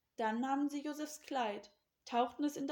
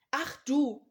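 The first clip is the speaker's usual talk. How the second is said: loud, indignant burst; cross, loud rebuke; snapping angrily; 2 semitones higher, 9 dB louder than usual. A narrator's reading. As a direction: round on him angrily. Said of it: "fuhren sie Josef an".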